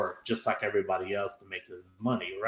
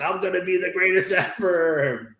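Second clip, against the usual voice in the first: bratty boast; 6 semitones higher than usual. Retold or imitated. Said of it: "gets mad and runs off like".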